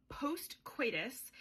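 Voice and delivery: hushed, slow whisper; whispered aside. Real, not acted. Telling viewers what happens after the spicy scene, slow whisper. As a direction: drop to a secret whisper. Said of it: "whispered aside".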